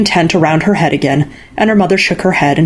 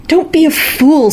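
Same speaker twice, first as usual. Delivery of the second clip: scolding mother; 11 semitones higher, slower than usual. A narrator's reading.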